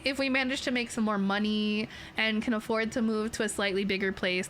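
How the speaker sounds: ordinary delivery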